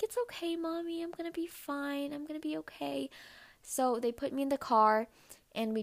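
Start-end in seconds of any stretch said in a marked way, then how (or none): 0.0-3.1 put-on much higher, soft little-girl voice; reassuring child. Little girl comforting her crying mom, much higher and softer. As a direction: comfort Mommy like a brave little kid.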